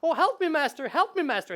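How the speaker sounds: high, loud mock cry for help; hammed-up panicky sidekick calling its master; rabbit pleading. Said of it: "the ogre bashes him and said".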